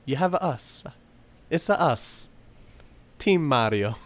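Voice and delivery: high, cartoon Italian plumber voice; Luigi. Luigi reassuring Mario before the race, cartoon Italian accent. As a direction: reassure a brother in a playful Italian lilt. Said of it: "And Luigi's like".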